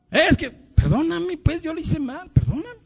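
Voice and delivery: high, plaintive mock plea; pleading apology. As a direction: act out begging forgiveness, pitched right up. Models asking an enemy for forgiveness.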